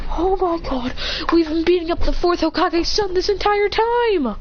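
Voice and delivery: acting out the kids' shocked realization; shocked gasp. Kids shocked at the revelation.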